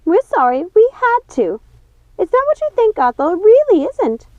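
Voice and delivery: high, loud, pleading witch voice; frantic sister. A narrator's reading. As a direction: plead high and fast to calm her. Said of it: "said Ruby".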